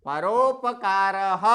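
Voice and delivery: परोपकारः spelled out slowly, full र and a breathy visarga at the end; careful full pronunciation. Shown as a demonstration. Teacher slowly modelling the word for students, visarga breathed out. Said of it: "उसके बाद विसर्ग आया है".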